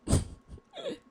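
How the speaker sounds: sudden high burst of a laugh; short amused high laugh. Real, not acted. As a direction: laugh at yourself, caught out. Laughs at own accent.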